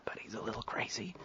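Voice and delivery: whispered gossip behind his back; mock whisper. Old-timer whispering gossip to a newcomer, hushed. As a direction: hush it like passing on a rumour.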